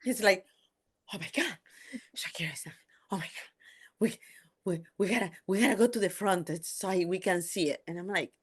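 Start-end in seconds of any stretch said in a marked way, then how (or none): 1.1-7.8 starstruck, stammering in broken bursts; mock a fan too overwhelmed to finish sentences; imitating unhinged husband. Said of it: "he was unhinged".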